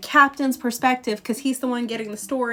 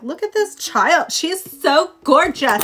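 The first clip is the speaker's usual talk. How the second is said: higher, louder gushing mother; gushing mom; 4 semitones higher, 6 dB louder than usual. Retold or imitated. Mom finds a street urchin, gushing loudly. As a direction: coo over the child like a smitten mom. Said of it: "his mom found ... who was like".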